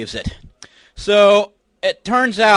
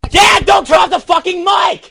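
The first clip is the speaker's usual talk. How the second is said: higher yelling at his dad; furious yell; 8 semitones higher than usual. Real, not acted. Son yelling at his dad not to cut the mic, furious. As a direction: scream to stop him cutting the mic.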